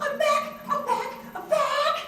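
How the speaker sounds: gasp, then very high, loud squealing; overjoyed shriek, hammed up; ecstatic squeal. Actress reacts to an audition offer, ecstatic squeal.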